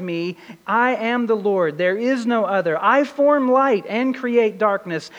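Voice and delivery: emphatic, measured reading from scripture; scripture proclamation. A narrator's reading. Preacher reads God's words in Isaiah to the congregation, scripture proclamation. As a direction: declare each clause firmly as God's own word.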